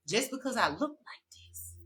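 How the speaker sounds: breathy whisper, soft; whispered aside to her critics. Real, not acted. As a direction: drop to a whisper to make the point. Defiant rant to her judges.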